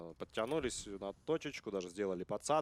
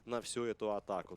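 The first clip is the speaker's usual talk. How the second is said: ordinary talk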